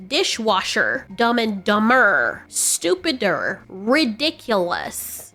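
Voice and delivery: slow list, leaning on the R in "Dishwasher", "Dumber", "stupider", "ridiculous"; hard R. Shown as a demonstration. Vlogger listing harmless hard-R examples, "Dishwasher" to "ridiculous", R sounds slowly emphasized. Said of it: "things that have a hard R".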